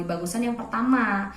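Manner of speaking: normal talking voice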